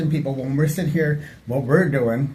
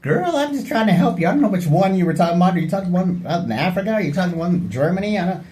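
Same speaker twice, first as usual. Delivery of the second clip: exasperated; 5 semitones higher than usual. Retold or imitated. Telling the lady on the bus she's impossible, high and exasperated. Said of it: "I'm like".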